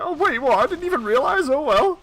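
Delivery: high, hammed-up faux innocence; mock innocent. Mocking the friend's fake innocence, high pitched. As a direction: play dumb, voice up high. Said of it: "innocent and be like".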